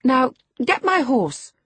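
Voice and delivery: high, loud haughty command; imperious girl. A narrator's reading. Girl addressing unknown kinsman as a servant, imperious high voice. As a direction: order him about like a stable boy. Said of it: "as she would one of the stable boys".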